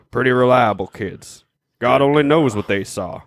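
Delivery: lightly voiced police chief; cop voice. Police chief recalls the kids' sighting, lightly put-on cop voice. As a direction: give a measured quote as a small-town cop. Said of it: "let me use my cop voice".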